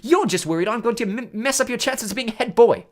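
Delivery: loud, high, heated retort; angry accusation. A narrator's reading. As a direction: fling the accusation back hotly. Ron angrily accuses Percy.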